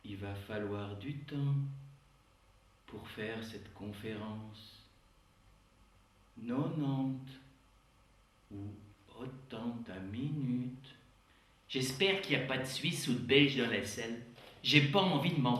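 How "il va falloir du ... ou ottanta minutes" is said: put-on softer, slow Swiss accent; slow, softer mock Swiss accent. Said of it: "Ou l'accent suisse".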